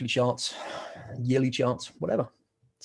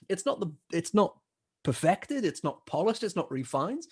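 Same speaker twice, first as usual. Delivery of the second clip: ordinary talk, nothing marked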